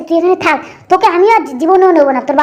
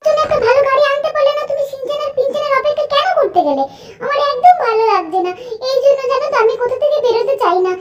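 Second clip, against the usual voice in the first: put-on high, softer pouting girlfriend voice; sulky rich girl; 6 semitones higher, 4 dB quieter than usual. Angel Priya complaining to Franklin about waiting in the sun, high and sulky. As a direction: whine like a spoiled rich daughter.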